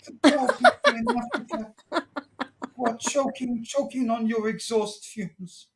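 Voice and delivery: high, laughing mock-hysterical rant; hammed-up Greta. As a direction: wail it as an over-the-top panicking activist. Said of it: "How dare you".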